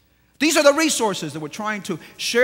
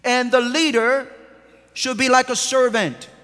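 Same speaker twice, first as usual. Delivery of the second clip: full-force preaching; 3 semitones higher, 4 dB louder than usual. Acted. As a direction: drive each phrase home to the church.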